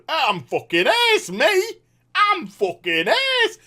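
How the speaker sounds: high, loud-ish cocky imitation; mock crowd-rousing boast. Villain working the crowd for a cheer, mock boastful. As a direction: brag to the crowd, drawing out the last one. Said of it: "tries to get a cheer off the crowd".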